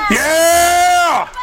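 long high cheer; cheering shout. Real, not acted. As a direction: whoop to the crowd. Cheering with protest crowd.

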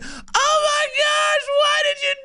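very high, slow, whiny fake sob; mock crying victim. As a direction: ham up a wailing crybaby. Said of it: "hit him right in the face".

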